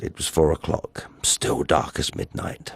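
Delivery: breathy whisper of the dark hour. A narrator's reading. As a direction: drop to a whisper for the darkness. Hushed midnight detail in horror tale.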